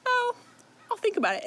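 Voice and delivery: high, sing-song taunting whisper; teasing taunt. Retold or imitated. Rhys taunting the begging Tamlin, high teasing whisper. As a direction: toy with him, airy and high.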